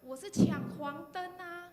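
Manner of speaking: high, slow, whiny protest; young woman loudly pleading her case; defensive girl. Retold or imitated. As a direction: imitate the girl insisting it was yellow. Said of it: "那个女生就说".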